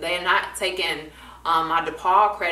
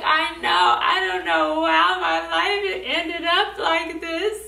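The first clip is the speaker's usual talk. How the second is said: high, loud, whiny wail with a laugh; mock despair; 11 semitones higher, 3 dB louder, slower than usual. Student to therapist, high laughing wail of despair. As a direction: wail it, half laughing at yourself. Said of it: "And I was just like".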